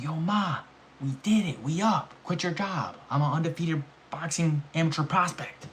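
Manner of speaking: high excited shout to mom; celebrating with mom; retold hype. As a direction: pitch it up, bursting with news.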